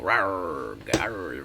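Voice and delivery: playful mock werewolf growl; werewolf roar. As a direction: growl it like a werewolf storming the festival. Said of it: "That's the sound of the werewolf".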